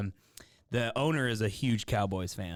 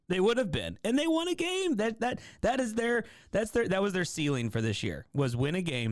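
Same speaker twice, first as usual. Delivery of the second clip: retold yelling at radio; 9 semitones higher than usual. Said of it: "I'm, like, yelling".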